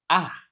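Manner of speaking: "Ah" starting high and falling; "Ah" in sharp fourth tone; falling realization. Acted. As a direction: drop "Ah" as if it just dawned on you. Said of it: "falling in realization".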